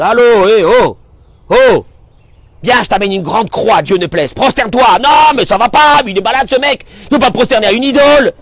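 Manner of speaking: loud, high indignant protest; mock outrage. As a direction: act scandalized at the very idea. Mock outrage at bowing idol.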